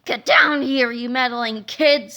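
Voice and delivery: loud roaring scream; screaming monster. Acted. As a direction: scream it down at the kids. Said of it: "screamed the Turbo Toilet two thousand".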